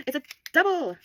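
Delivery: high loud delighted cry; surprised gasp. Real, not acted. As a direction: gasp then exclaim.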